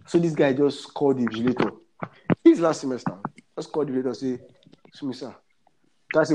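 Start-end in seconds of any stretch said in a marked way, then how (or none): 4.9-5.3 meek plea. Retold or imitated. Student begging invigilator, retold.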